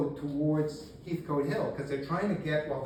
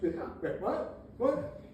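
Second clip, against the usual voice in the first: high, questioning mock confusion; baffled; 8 semitones higher, much slower than usual. Virginians react to being told to surrender, acted bafflement. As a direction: pitch each "what" sharply up, puzzled.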